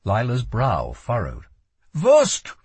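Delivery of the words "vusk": urgent foreign command; shouted order. A narrator's reading. Boy guard blocks her from the door, shouted order. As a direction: repeat the command high and loud. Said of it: "he said again".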